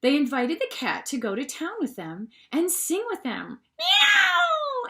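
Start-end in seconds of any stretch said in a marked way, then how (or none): 3.8-4.9 very high, loud meow; cat meow. A narrator's reading. The cat agreeing to come, a high meow. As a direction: mew like a cat. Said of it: "answered the cat".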